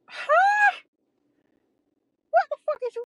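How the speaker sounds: very high, loud incredulous huh; shocked shriek. Retold or imitated. Hearing the person confess, a high shocked shriek. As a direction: shriek it in total disbelief.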